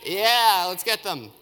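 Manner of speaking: loud cheer, easing on the last words; rally the mob with a high shout; acted cheer of approval; crowd shout. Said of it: "all the Samaritans shouted".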